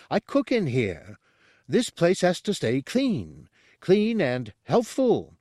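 high-pitched, indignant chef's protest; shouted. A narrator's reading. Chef defends the kitchen to the guard, shouting. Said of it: "Porcellus shouted".

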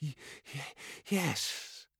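stammering fear. Acted. Cowed sorcerer before master.

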